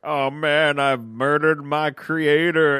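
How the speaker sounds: hammed-up robot remorse; mock anguish. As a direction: wail slowly in comic dismay. Retold robot realizes it killed creator.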